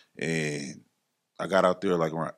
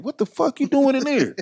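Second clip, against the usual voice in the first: much higher mock outrage; outraged disbelief; 3 dB louder than usual. [name] on the phone hearing about the temp service, high mock outrage. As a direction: sound appalled at the temp job.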